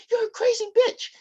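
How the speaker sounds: very high, loud retort; mock outraged yell. Son to his attacking mother, hammed-up high yell. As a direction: imitate a kid screaming an insult. Voicing the fleeing son's outburst.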